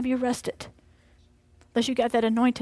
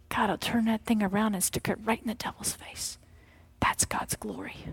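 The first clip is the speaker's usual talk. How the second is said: hushed; 3 semitones lower, 7 dB quieter than usual. Real, not acted. Hushed climax of sermon.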